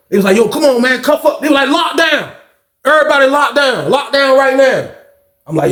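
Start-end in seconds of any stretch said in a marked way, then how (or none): 0.4-1.4 high-pitched mock officer; retold officer order. Officers ordering inmate to cuff up. 1.7-4.9 high-pitched guard's call; retold lockdown order.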